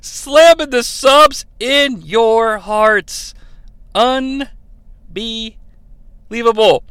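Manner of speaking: drawn-out, amused repeat of the line; slow savoring. Real, not acted. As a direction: savor every word slowly. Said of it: "speaker savors the line, slowly".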